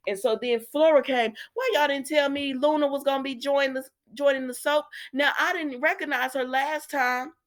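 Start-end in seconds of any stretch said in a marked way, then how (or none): 1.5-4.9 high-pitched astonished question; mock indignant surprise. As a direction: ask it high, as if left out. Retells reaction to Luna's arrival.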